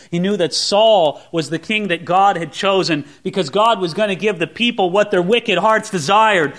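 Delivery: usual speaking voice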